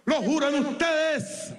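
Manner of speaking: slow, drawn-out call to the crowd; booming oath call. Real, not acted. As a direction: boom the question out to the troops. (pregunta lenta y atronadora a la tropa)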